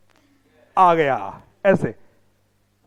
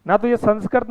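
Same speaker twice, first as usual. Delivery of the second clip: usual speaking voice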